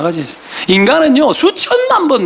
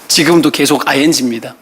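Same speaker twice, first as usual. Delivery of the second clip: usual speaking voice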